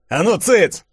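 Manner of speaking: high, loud barking soldier; barked order. A narrator's reading. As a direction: bark them silent. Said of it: "гаркнул красноармейский валет". (солдат гаркает на толпу)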